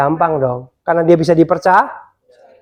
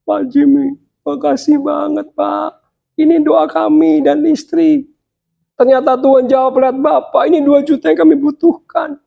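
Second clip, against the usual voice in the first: grateful pastor; 10 semitones higher than usual. Retold or imitated. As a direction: thank him like a moved pastor.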